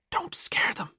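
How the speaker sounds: breathy whisper, voiceless. A narrator's reading. Valkyrie whispering a warning to Skullduggery. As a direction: hush it so they don't hear. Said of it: "Valkyrie whispered".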